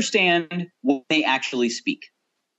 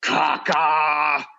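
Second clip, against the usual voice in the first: angry; 2 semitones lower than usual. Acted. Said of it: "And then Atuk says angrily".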